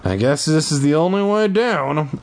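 normal talking voice

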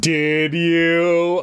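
demanding. Acted. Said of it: "I demanded".

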